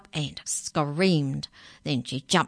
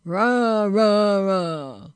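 loud, higher bear roar falling away; bear talk; 4 semitones higher, 7 dB louder, much slower than usual. Acted. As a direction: roar it three times as a bear. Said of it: "they talked bear talk".

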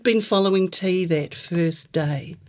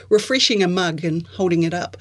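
ordinary delivery

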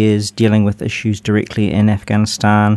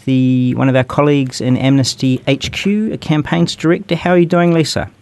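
upbeat, higher radio host greeting; radio host intro; 4 semitones higher than usual. Acted. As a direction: open the show like a cheerful presenter. Opens the radio show segment.

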